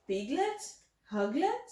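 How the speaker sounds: ordinary delivery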